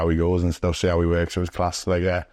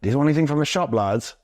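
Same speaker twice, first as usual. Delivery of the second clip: higher, quick, chirpy mock voice; imitating a stranger; 6 semitones higher than usual. Mimicking a stranger offering to go to the shop, higher and fast. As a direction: rattle it off bright and high like an odd friendly stranger. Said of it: "And he goes".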